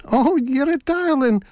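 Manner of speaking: high put-on granny voice; wolf posing as a granny; fake old lady. A narrator's reading. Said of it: "his best old lady's voice".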